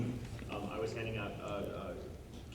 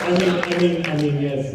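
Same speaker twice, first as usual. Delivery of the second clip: loud flustered stammer; flustered protest; 4 semitones higher, 10 dB louder than usual. Real, not acted. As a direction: blurt out, caught off guard. Flustered refusal then yielding.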